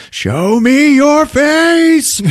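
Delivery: long high shout, stretched out; yelled. Retold or imitated. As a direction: bellow it into the dark alley. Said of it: "then he yells out".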